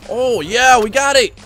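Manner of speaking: loud, high victory whoop; triumphant. Real, not acted. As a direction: whoop and crow over the win. Celebrates winning the challenge.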